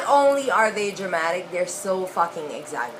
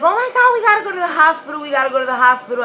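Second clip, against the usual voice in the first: panicked relatives; 8 semitones higher, 6 dB louder, faster than usual. Retold or imitated. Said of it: "everybody's like".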